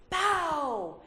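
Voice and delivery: mock impact noise in a whisper; whispered bang. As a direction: breathe out a voiceless "bow". Crash impact in the story.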